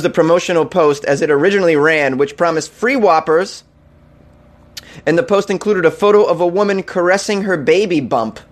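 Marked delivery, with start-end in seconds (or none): none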